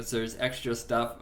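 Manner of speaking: ordinary delivery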